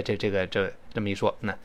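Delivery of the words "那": ordinary delivery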